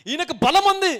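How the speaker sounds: high, forceful certainty; emphatic conviction. Acted. Doubter granting that God has strength, loudly emphatic. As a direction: ring it out loudly. Acting belief against following doubt.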